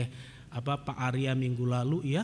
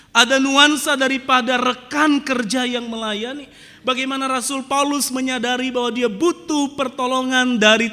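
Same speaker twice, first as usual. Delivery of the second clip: loud, driving sermon delivery; forceful preaching; 8 semitones higher, 8 dB louder than usual. Acted. Pastor hammering his point to the congregation, loud. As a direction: preach it out to the whole church.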